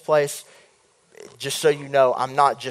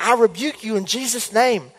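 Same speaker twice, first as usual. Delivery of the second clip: higher, put-on voice for the wizard; wizard rebuking; 8 semitones higher than usual. The wizard rebuking the dreamer, lightly voiced. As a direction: declaim the rebuke. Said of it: "and he was like".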